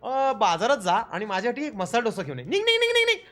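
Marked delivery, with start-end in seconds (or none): 2.5-3.2 high, urgent shoo-off repeated fast; shooing order. Pretend. Ordering his pretend AI servant out, high and urgent. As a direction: chase the servant out the door with each word.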